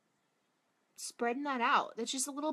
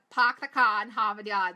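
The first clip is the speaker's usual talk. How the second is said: Boston accent, Park the car in Harvard Yard said louder, every r dropped; 8 dB louder than usual. Shown as a demonstration. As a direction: punch it out in broad Boston. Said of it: "That's what people like to say".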